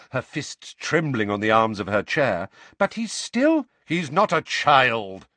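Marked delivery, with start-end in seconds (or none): 2.8-3.7 high, loud and rising; rising anger, barely held; angry mother rising. A narrator's reading. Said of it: "her voice rising". 3.9-5.3 slow, louder insistence; impatient Sirius. A narrator's reading. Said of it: "said Sirius impatiently".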